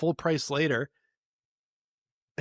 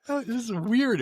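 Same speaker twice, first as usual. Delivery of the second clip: high, loud, baffled aside; incredulous aside; 9 semitones higher, 5 dB louder than usual. Retold or imitated. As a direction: squeal it out, utterly baffled. Retelling own reaction, high voice.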